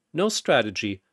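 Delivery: ordinary delivery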